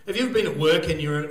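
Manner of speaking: ordinary delivery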